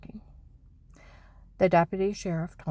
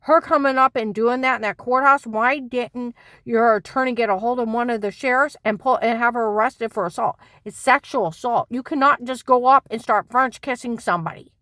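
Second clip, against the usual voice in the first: higher, louder outraged tirade; heated rant; 4 semitones higher, 8 dB louder than usual. Real, not acted. Wife ranting to viewers about the courthouse kiss, loud. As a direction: pour out the indignation. Worked up retelling the assault.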